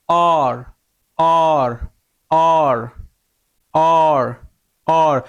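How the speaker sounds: অর with rounded lips, held and falling. Shown as a demonstration. Teacher drilling what O and R make, round-mouthed অর. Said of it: "কুল করতে হবে".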